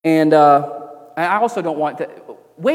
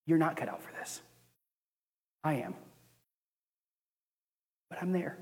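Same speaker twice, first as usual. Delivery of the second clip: gentle reassurance; 14 dB quieter than usual. Retold or imitated. God's reply retold.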